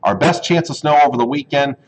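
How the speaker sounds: normal talking voice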